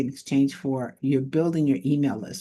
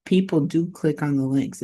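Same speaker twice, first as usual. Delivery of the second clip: ordinary talk, nothing marked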